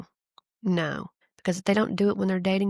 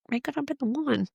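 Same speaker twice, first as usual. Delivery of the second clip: a hint of fake longing, pitched up; mock wistful; 7 semitones higher than usual. Host teasing the listener, mock wistful. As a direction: tease it, pretending to mourn. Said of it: "I'm just kidding".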